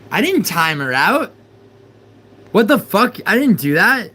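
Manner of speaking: high, loud alarmed disbelief; panicked shock. Real, not acted. Streamer realizing he timed out a viewer by accident. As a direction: yelp in real alarm.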